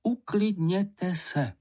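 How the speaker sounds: drawn-out, insistent soothing; calming. A narrator's reading. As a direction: stretch it out syllable by syllable. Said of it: "pravila maminka".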